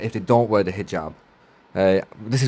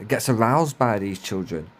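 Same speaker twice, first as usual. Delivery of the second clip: ordinary talk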